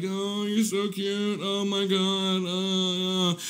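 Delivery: soft, slow mock cooing; hushed, drawn-out parody of doting; imitating cat cooing. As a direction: draw it out softly, gushing over a pet. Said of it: "She's like".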